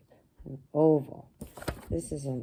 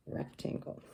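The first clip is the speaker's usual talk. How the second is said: whisper; 11 dB quieter than usual. Shown as a demonstration. Naming shape softly after explanation.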